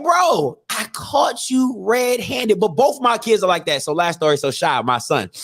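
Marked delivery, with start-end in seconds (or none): 0.0-2.6 slow, high disbelief; exasperated. Retold or imitated. Scolding daughter caught red-handed, slow exasperation. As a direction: drag it out in disbelief.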